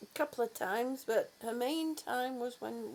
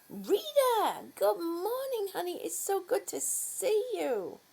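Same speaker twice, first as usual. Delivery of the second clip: high, bright surprised hello; delighted greeting; 6 semitones higher than usual. Real, not acted. Owner greeting her other dog Rita, delighted and higher.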